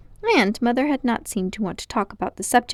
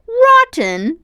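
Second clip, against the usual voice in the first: much higher, louder echoed question; puzzled; 14 semitones higher, 9 dB louder than usual. Acted. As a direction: echo the word in disbelief. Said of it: "with a puzzled look".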